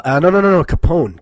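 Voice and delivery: real laughing-edge correction; loud self-correction. Real, not acted. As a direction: blurt out the fix, catching yourself. Catching his own slip.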